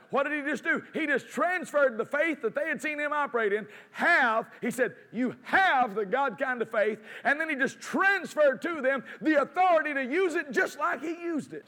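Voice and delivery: high-pitched, forceful sermon delivery; fervent preaching. Real, not acted. Preacher explaining transferred authority to congregation, fervent. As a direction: drive it home excitedly, hitting key words hard.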